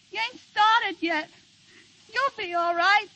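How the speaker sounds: begging him to pull through, near sobbing; tearful pleading. Acted. Woman begging her collapsed partner, tearful pleading.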